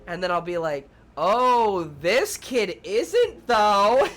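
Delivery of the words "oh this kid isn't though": loud, high, drawn-out pointed dig; mock public shaming. Preschool teacher shaming a misbehaving kid, loud and exaggerated. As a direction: proclaim it so the whole class hears. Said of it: "I try to shame them".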